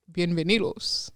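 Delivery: Spanish accent, lightly imitated; Duolingo Spanish voice. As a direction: mimic a language-app voice. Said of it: "sound like the Spanish translator on Duolingo".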